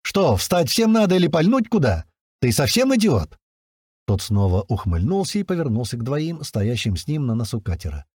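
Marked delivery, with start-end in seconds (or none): 0.0-3.4 heated, louder and higher; angry. A narrator's reading. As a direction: lash out in irritation. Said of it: "начал заводиться я".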